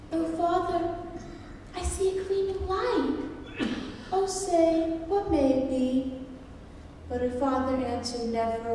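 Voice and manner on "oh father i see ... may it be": put-on high, girlish questioning voice; little daughter. A narrator's reading. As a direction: voice a little girl calling to her father, pitched well up. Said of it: "had taken his little daughter".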